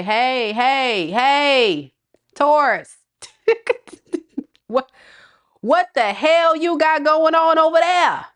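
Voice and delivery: high, loud teasing call to Taurus; nosy ex calling out to Taurus, high and loud; mock calling out. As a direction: sing out a nosy hey hey at them. Voicing the person calling Taurus.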